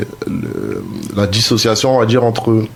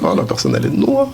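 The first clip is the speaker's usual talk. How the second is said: mocking tone; 6 semitones higher than usual. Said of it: "on emploie un ton assez péjoratif".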